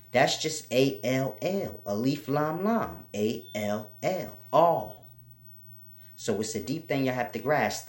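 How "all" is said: "all." higher and louder, sustained; all drawn out. Shown as a demonstration. Linking the English word all to Allah, louder and higher. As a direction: ring out "all." to link it to Allah. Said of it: "The word all is the same".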